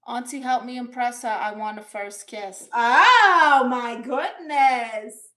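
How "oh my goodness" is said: loud, drawn-out "oh my goodness"; big, high-rising exclamation; exclaiming. Real, not acted. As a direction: gush it out loud, stretching the words. Reacting to first kiss request.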